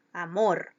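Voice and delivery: "Amor" with the weight on "mor"; stress on last syllable. Shown as a demonstration. Teacher answering where the voice loads, final stress on "Amor". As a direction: land firmly on the end of "Amor". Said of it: "En la última sílaba".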